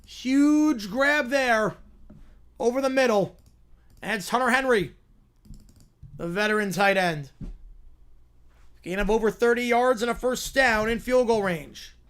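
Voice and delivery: lively TV announcer calling the drive; play-by-play call. Acted. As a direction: call the game like a network broadcaster.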